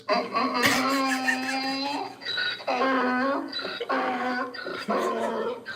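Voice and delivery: long high cackling laugh; howling laugh. Real, not acted. Streamer howls with laughter over the donkey joke.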